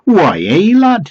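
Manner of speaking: high, loud, hammed-up Geordie; mock Geordie accent. Door-to-door caller greets a householder, mock Geordie accent. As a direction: put on a dodgy Geordie accent. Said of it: "I don't think it was a genuine accent".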